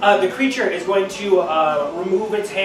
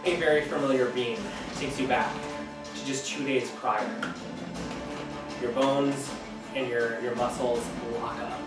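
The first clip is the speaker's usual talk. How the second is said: story narration; 2 semitones lower, 7 dB quieter than usual. Acted. DM narrating with music.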